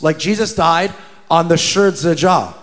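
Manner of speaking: ordinary talk, nothing marked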